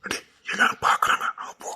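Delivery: urgent whisper; hushed warning. Acted. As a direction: hiss a private caution so onlookers don't hear. Warns that everyone is watching.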